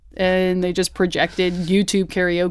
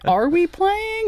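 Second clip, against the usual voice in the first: unsure high question; 12 semitones higher, much slower than usual. Retold or imitated.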